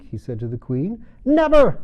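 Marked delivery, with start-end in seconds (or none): 1.3-1.8 shrieked, very high and loud; furious. A narrator's reading. Queen to the king, furiously denying she ever had fits. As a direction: explode at the insult. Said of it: "said the queen furiously".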